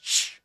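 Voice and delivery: short retaliatory shush; shush back. Acted. Barum shushes back at the audience, short shush. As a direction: shush right back at them. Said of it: "back at them".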